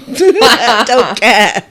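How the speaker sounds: loud, drawn-out "I don't care" with a laugh; mock defiance. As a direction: playfully belt it out, stretching the words. Playful defiance about joining book clubs.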